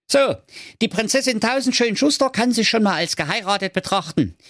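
high, cocky braggart voice; boastful prince. A narrator's reading. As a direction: show off like a smug prince. Boastful prince Humphrey speaks. (großspuriger Prinz)

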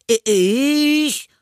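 put-on high stammered boy's outcry; indignant. A narrator's reading. Anton denies playing with matches, indignant. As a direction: stammer it high in hurt innocence. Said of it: "rief Anton empört".

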